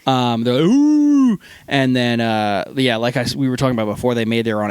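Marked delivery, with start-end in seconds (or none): 0.6-1.4 high, loud ape-like whoop; a drunk sailor hooting like an animal. Retold or imitated. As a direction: whoop like a chest-beating animal. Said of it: "like, acting like animals".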